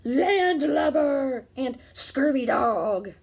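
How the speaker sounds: slow loud pirate growl; boy showing off pirate words; pirate talk. Acted. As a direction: savour each pirate insult. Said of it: "say real pirate stuff like".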